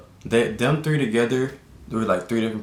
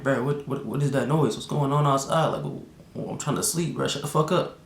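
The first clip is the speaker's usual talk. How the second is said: higher-pitched mock exasperation; retold irritation; 5 semitones higher than usual. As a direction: act out being woken up annoyed. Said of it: "I'm mad".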